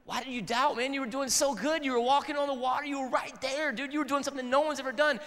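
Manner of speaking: high, fast, excited surfer-dude voice; casual buddy Jesus. Pretend. Jesus ribbing Peter back in the boat, excited dude voice. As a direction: hype him up like a surfer buddy. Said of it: "he's like".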